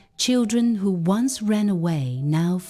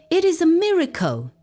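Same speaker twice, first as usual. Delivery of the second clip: higher, quick burst of joy; amazed exclaim; 11 semitones higher, 3 dB louder, much faster than usual. Acted. Father marveling at his healed family, excited and high. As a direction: blurt it out in wonder.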